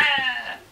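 high laughing mock shriek; Danielle scream imitation. Dad mimicking his daughter tasting shrimp chips, mock shriek. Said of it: "she'll do her typical Danielle voice".

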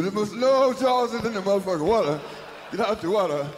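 loud urgent warning; alarmed warning. Retold or imitated. Audience hears the music, loud urgent warning. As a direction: shout it like a warning to the crowd.

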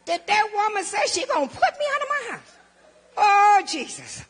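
high, loud cry with a laugh; half-laughing panic at the news; alarmed disbelief. Retold or imitated. Threatened with losing her house.

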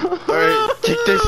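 mock crying. Tearful mock-dramatic.

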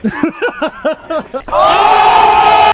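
loud burst of cackling laughter; a loud cackle that breaks out after a joke. Real, not acted.

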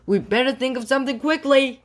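high, fast, pressing voice; rushed storybook urgency; urgent. A narrator's reading. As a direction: hurry the others along. Urgent plea as pursuers approach.

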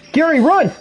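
high panicked shriek; panicked scream. Real, not acted. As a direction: scream in terror for your friend. Friend attacked by killer.

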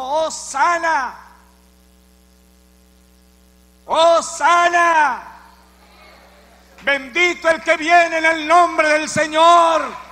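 slow, drawn-out loud hosannas; stretched, ringing cries of praise; crowd acclaim. Acted. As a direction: shout it like a jubilant crowd.

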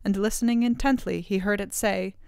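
ordinary delivery

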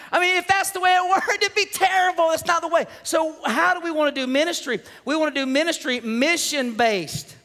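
high-pitched, driving pulpit delivery; preaching. Real, not acted. Preacher calls the church to mission-based ministry, preaching. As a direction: preach it out to the whole room.

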